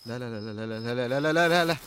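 rising panic, no after no; panicked no's. Acted. Sees a man standing there. (ذعر متصاعد من همس لصراخ)

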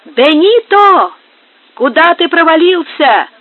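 workers yelling high and loud across the yard; shouting. A narrator's reading. Workers shout for the lazy Benito across the yard, loud call. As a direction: call him out, drawn out and loud. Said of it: "кричали прочие работники".

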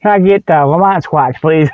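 usual speaking voice

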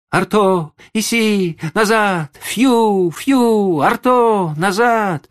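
high, loud, piercing calls; alarmed shouting. Acted. Boy calling the lost dog across the stream, frightened shouting. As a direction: yell for the runaway dog in alarm. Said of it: "пронзительно свистал и кричал громко, с беспокойством и испугом".

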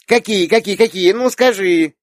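put-on high, loud, insistent child; small girl begging to be told; pestering little girl. A narrator's reading. Little girl pesters aunt for her thoughts, insistent.

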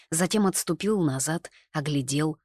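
usual speaking voice

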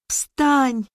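put-on high, alarmed child's voice; high-pitched distressed calling; girl crying out. A narrator's reading. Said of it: "закричала она".

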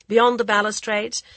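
ordinary delivery

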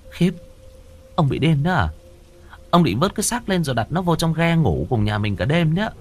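high, incredulous woman's scolding; scolding wife voice. Acted. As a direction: scold him in disbelief.

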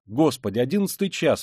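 exclaiming. Acted. Mother spotting the time on her watch, crying out. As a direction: cry out at the late hour. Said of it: "вскрикнула она".

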